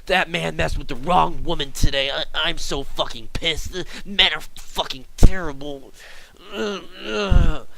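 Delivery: put-on high, loud old-lady rant; angry rambling. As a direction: rant as the fuming customer, ending in grunts.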